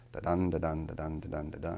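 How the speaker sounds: da-dun repeated evenly, each dun stressed; steady weak-strong beat on da-dun; basic iambic pattern. Shown as a demonstration. Teacher sounds out the home rhythm, iambic pattern. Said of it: "basic pattern that we get used to".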